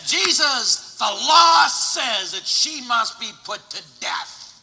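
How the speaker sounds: loud, slow, accusing cry to Jesus; demanding shout. Retold or imitated. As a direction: shout the law at him like an angry crowd.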